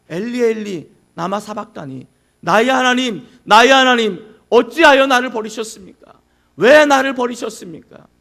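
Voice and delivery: loud, desperate cry of protest; anguished protest. Acted. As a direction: cry out as if abandoned by the Father. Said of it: "라고 항변하는 거예요".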